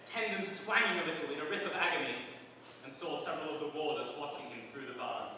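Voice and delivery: usual speaking voice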